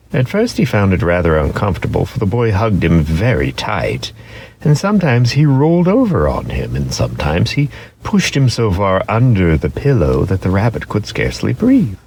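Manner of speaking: usual speaking voice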